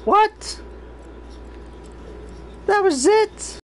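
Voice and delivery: high, stunned disbelief; can't believe it's over; shocked disbelief. Real, not acted. Disappointed by the tiny prize.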